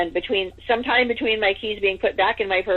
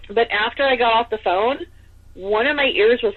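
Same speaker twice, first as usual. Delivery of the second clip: ordinary talk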